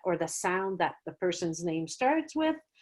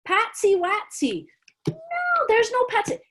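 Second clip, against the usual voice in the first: much higher playful back-and-forth; silly guess then kid reply; 13 semitones higher than usual. Acted. Teacher playing both a silly guess and the kids' reply, much higher. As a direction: ham up the joke.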